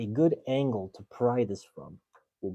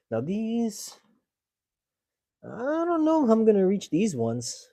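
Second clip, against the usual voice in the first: straining; 11 semitones higher, 9 dB louder than usual. Real, not acted. Straining to reach connectors.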